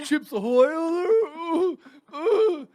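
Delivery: high, loud, slow squeal through laughter; squealing mock teacher. Friends riffing on teacher, hammed-up squealing mock voice. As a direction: ham up the teacher in a shrill squeal.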